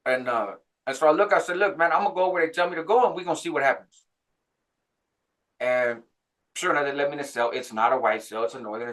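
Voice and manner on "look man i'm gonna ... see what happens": higher, quick retold retort; irritated. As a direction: push back at the naggers, fed up. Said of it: "I'm getting increasingly irritated".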